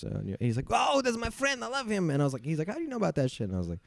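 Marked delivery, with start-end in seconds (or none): 0.7-3.5 high, bright-eyed mock guide; imitating the guide's delight at the comic's name, much higher; excited guide voice. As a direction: squeal it like the thrilled guide.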